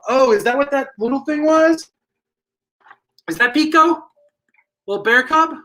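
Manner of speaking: surprised. Real, not acted. Surprised discovery about Pico.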